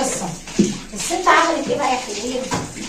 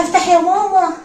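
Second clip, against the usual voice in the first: higher, louder retold call at the door; urgent calling out; 6 semitones higher, 5 dB louder than usual. Daughter running to get her mother to open up, calling out urgently.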